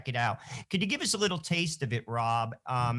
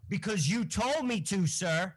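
light, higher Forrest Gump impression; imitating Forrest Gump; 4 semitones higher than usual. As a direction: say it simply and obediently like Forrest Gump, pitched up. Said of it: "like our friend Forrest Gump".

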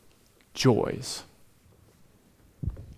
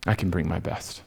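low and very quiet, barely voiced; very soft prayer; 11 semitones lower, 10 dB quieter than usual. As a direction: murmur the resolve to God. End of whispered prayer.

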